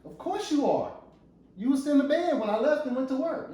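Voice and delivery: high-pitched indignant comeback; accusing husband. Acted. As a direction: argue back as an aggrieved husband. Husband accusing wife in acted quarrel.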